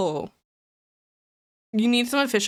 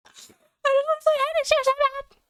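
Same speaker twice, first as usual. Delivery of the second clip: much higher, almost sung; high whiny longing. Real, not acted. Streamer begging to play Hades Two, high and whiny. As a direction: whine it out with longing.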